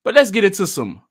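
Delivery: usual speaking voice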